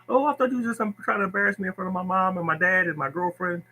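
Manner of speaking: high mock whine of a sulking player; whiny player. Player whining to coach, high mocking whine. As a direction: play the hurt kid who thinks he's being shamed.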